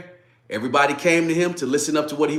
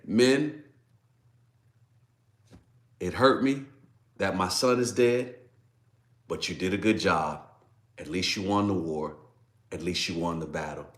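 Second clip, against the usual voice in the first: fading softer and lower line by line; hushed consolation; 7 semitones lower, 6 dB quieter than usual. Retold or imitated. David quietly consoling his men, softer and lower. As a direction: let it sink to a low, tender murmur.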